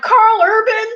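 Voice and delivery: high, loud gasped name; shocked disbelief. Retold or imitated. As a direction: blurt it out starstruck. Stunned to hear the celebrity.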